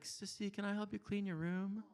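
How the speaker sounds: put-on high, sweet kid voice; little brother voice. Boy offering to clean sister's room, high sweet voice. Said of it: "he'll be like".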